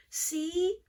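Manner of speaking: put-on a child catching a new idea; Franklin's child voice. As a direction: lift it up eagerly.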